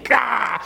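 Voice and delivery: high frustrated gah, mock; imitating son's gah. Six-year-old son over his workbook, a high frustrated gah. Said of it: "he'll do this, the same thing".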